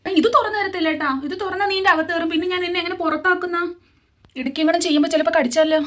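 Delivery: ordinary delivery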